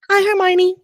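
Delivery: bright, smiling high greeting; cheerful greeting. A narrator's reading. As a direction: greet her with a grin. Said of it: "with a wide smile".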